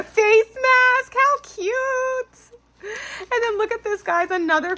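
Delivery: high, loud delighted squeal over a face mask; excited squeal. Real, not acted. Unboxing a gift swap, she squeals at the face mask.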